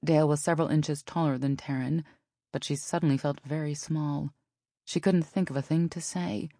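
usual speaking voice

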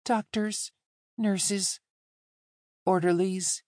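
put-on high, halting boy's voice; young boy. A narrator's reading. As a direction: list them one at a time in a child's high voice. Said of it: "shrinking back into boyhood".

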